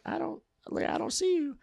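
tiny high, hushed voice; retold girl voice. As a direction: imitate her in a soft falsetto. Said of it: "and she's like".

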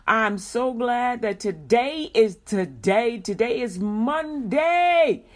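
big radio-host build-up; excited announcement. Real, not acted. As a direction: stretch each key word with rising gusto. Hyping the day on air.

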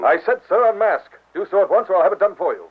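stern order. Acted. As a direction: snap the order with authority.

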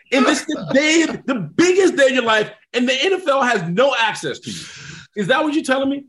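high, mounting agent tirade; incredulous scolding. Retold or imitated. Agent to player on draft day, incredulous scolding. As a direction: imitate an agent losing it, stressing every failing.

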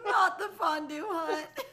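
high, drawn-out words through laughter; laughing while talking. Real, not acted. Friend cracking up over the fondue hut, laughing. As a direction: wail it slowly while cracking up.

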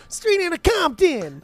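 mocking a rapper by stretching the title. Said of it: "If you're gonna do Easy, you go".

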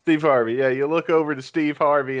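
ordinary talk, nothing marked

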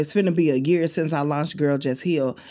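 ordinary talk

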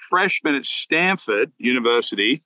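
ordinary talk

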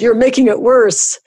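high-pitched howled complaint; mock howl. A narrator's reading. Husband to wife trying to help, mock howling. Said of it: "he howled".

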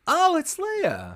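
high, drawn-out "oh" of discovery; slow, high-pitched acted surprise; delighted realization. Host as first-time viewer at the helmet reveal, acted surprise.

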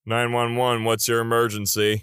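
lightly voiced emergency operator; even, businesslike pickup; dispatcher. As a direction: answer like a dispatcher taking a call. Acted emergency dispatcher call.

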